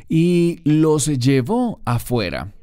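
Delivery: slow, with "afuera" pressed hard; stress on afuera. Shown as a demonstration. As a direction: lean into "afuera" and draw it out slowly. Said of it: "está enfatizada la palabra afuera".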